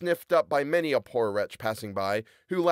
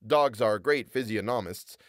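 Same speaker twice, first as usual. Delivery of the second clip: ordinary delivery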